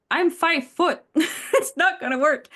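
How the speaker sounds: giggling through the words; laughing. Real, not acted. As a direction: laugh while admitting it. Jokes about own height, laughing.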